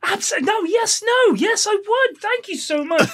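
high, overly polite voice; painfully polite. Pretend. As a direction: gush with eager politeness. Acted polite host exchange.

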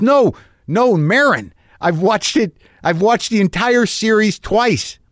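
high, insistent fan enthusiasm; excited. Retold or imitated. Fan correcting the host about which show, excited. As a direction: insist on your devotion, pitched high. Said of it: "see him be the excited one".

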